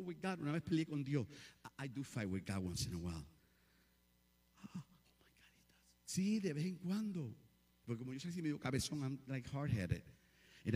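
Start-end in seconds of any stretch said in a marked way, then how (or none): 4.6-6.0 breathy hushed whisper; whispered aside. Real, not acted. Preacher confessing she fights with God, whispered. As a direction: drop to a secret whisper.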